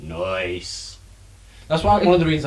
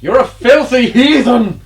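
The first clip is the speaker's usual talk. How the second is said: loud, high accusing yell; hammed-up scolding shout; mock outraged shout; 12 semitones higher, 12 dB louder than usual. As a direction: shout a playful insult at full volume. Mock scolding over ice in drinks.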